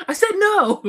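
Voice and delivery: loud, high mock outburst; freaking out. Imitating the driver shutting down argument, mock freak-out. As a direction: imitate an exasperated shout, half laughing. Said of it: "Freaks out".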